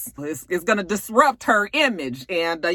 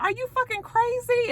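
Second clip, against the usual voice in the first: pitched way up in disbelief; incredulous high squeal. Retold or imitated. Trace stares at Mel in a high incredulous squeal. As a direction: shriek it like he's lost his mind.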